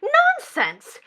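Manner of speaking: high, sharp exclamation; impatient dismissal. Acted. Daughter overrules madam's refusal, impatient.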